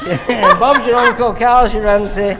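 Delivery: loud, high laughing delivery. Real, not acted. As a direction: say it through laughter, loud and slowed at the end. Laughing over a silly saying.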